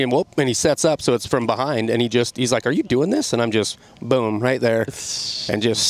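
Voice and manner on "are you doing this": much higher, startled retold question; surprised question. Cameraman startled as the sled takes off, surprised question. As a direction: caught off guard mid-shot.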